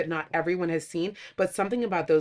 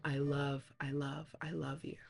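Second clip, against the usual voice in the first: much softer, tender and fading; soft romantic quote; 6 semitones lower, 12 dB quieter, slower than usual. Retold or imitated. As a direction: breathe it out like a confession. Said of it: "Pride and Prejudice".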